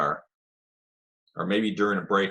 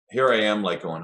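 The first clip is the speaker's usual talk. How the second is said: ordinary talk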